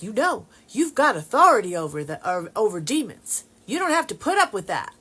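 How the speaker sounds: high, loud mimicked Texas accent. Texan cousin asserting authority over demons, emphatic imitated Texas accent. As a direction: lay down the law like a Texan cousin. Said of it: "she's saying it in her Texas accent".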